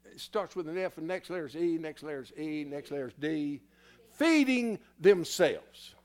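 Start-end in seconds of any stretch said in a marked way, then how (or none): 4.1-6.0 emphatic shout. Real, not acted. Preacher hammers the key word.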